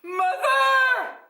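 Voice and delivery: very high, loud shout; piercing, drawn-out child's call. A narrator's reading. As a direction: cry out from a crowd to a parent. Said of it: "a voice called out from the crowd".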